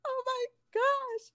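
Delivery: very high, drawn-out awe; stretched fan gasp of amazement, acted out; starstruck. Cheerleader retelling her reaction to the singer, starstruck.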